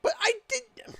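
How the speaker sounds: high-pitched whiny protest. Real, not acted. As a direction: squeak it in protest. Squeaky protest.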